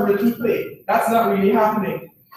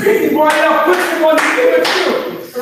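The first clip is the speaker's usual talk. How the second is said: high, loud mock pleading; hammed-up, high-pitched plea; mock movie distress; 8 semitones higher, 5 dB louder than usual. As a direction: cry out at the screen in playful anguish. Acting out tearful Titanic film viewer.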